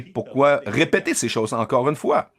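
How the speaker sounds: usual speaking voice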